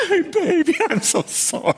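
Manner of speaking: high-pitched apology, hammed up; mock remorse. Boyfriend regretting his outburst, mock apologetic. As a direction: backpedal like a regretful movie boyfriend. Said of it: "he has one of those moments".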